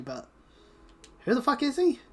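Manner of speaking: ordinary talk, nothing marked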